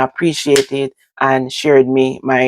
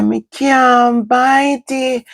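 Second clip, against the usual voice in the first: put-on high, slow child's whine; whiny child; 11 semitones higher, much slower than usual. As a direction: stretch it out, pleading like a little girl. Child whining she can't bite.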